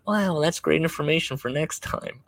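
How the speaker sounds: high-pitched polite mock enthusiasm. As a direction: imitate a wife being sweetly polite. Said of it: "My wife's being very polite".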